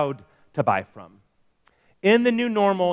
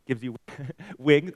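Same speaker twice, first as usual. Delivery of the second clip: a laugh breaking into the slogan; 5 semitones lower, 7 dB quieter than usual. Real, not acted. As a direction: chuckle through it, amused.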